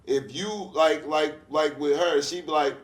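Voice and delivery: ordinary talk, nothing marked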